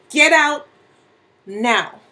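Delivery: loud, punched order with a pause before the last word; sharp angry shout; furious command. Acted. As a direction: order them out, no argument. Repeats order to leave.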